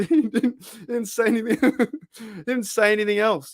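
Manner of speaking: high voice through laughter; trying to talk while cracking up; laughing. Real, not acted. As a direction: laugh your way through the words. Laughing as he retells it.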